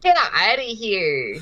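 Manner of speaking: drawn-out dismissive scoff; Teen Girl scoffing. Acted. Teen Girl mocking the boy's story. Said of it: "Teen Girl number two says".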